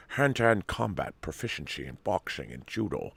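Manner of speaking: badly faked Scottish accent; hammy, slightly hushed Scottish Bond voice; Sean Connery. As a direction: read the spy dossier in a mock Scottish accent. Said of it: "this is a terrible accent".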